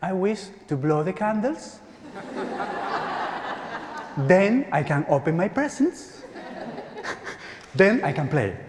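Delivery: put-on high, giggly child's voice; a nephew telling his birthday wish, high and giggling; little boy voice. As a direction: play a delighted small boy, laughing. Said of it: "He said".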